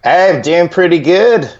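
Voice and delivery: put-on a weak try at an Australian accent; attempted Australian accent. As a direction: try an Aussie greeting and give up on it. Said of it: "I can't do the Australian accent".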